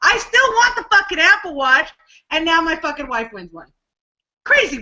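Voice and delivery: normal talking voice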